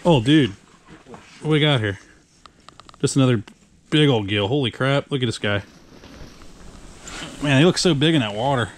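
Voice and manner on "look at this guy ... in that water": usual speaking voice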